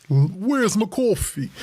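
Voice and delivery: drawn-out New Yorker accent, lightly done; slow New York accent. Shown as a demonstration. Co-host trying a New York accent on a chat request, slow. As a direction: stretch it out like a mock New Yorker. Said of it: "a super deep voice".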